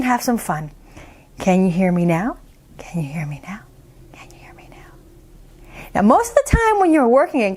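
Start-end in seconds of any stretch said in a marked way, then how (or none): 2.9-3.6 low, very soft voice; much softer, low close-up voice; getting quieter. Shown as a demonstration. Volume experiment, getting quieter. 4.1-4.9 faintest possible whisper; tiny voiceless whisper; barely audible whisper. Shown as a demonstration. Volume experiment, quietest take.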